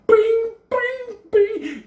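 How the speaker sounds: high, loud ringing bing bing; bell sound. Retold or imitated. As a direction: ring it out like a struck metal bowl. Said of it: "like, a fucking bell sound".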